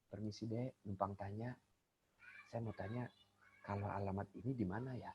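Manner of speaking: hushed, low-pitched polite enquiry; speaking softly. Acted. Passer-by asking someone for directions, very softly. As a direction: ask very gently, almost under your breath. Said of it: "Kalau orang ngomong pelan".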